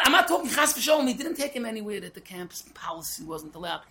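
usual speaking voice

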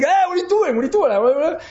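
acted protest. Roommate to the bocher taking his things, acted indignant protest.

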